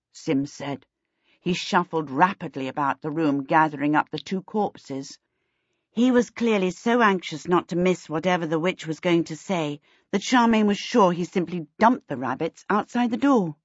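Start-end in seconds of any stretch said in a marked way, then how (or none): none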